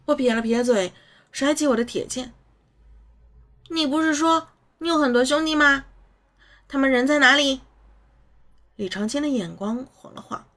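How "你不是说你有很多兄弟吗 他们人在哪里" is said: put-on higher child voice; boy pressing a question; curious child. A narrator's reading.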